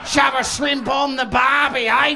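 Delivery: loud cartoon Aussie; broad Australian accent. Comic parodying himself with barbie slang, broad Australian shout. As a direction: bellow it in thick Australian. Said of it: "My accent gets fucking broader".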